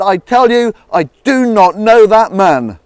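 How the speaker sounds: high, loud, slow desperate oath; nervous sworn denial. Acted. Peter to the bystanders, panicked loud sworn denial. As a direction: swear it out in panic. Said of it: "Peter got really nervous, and he swore".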